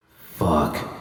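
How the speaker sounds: low, soft muttered curse under breath; the penny drops, quietly; caught-out curse. Acted. V realising Judy caught her, low dismayed curse.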